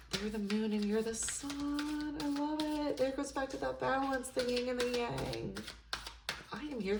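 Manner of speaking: a soft, awed gush; soft delight. Real, not acted. Reader to Taurus viewer, softly gushing over the moon and sun. Moved by the card message.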